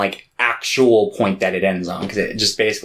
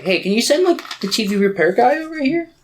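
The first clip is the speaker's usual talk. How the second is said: much higher, sing-song coaxing aunt voice; sugary scheming aunt; 9 semitones higher than usual. Retold or imitated. Aunt sweetly asking her nephew to send the repairman, high and coaxing. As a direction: coax the favour out sweetly, way up high.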